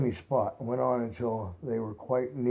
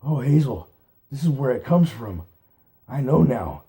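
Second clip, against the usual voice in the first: shivering, scared pleading; frightened; 2 semitones higher, 8 dB louder than usual. A narrator's reading. Fiver to Hazel, louder and frightened. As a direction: cower and plead in fear. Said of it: "Fiver shivered and cowered down".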